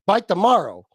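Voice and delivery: usual speaking voice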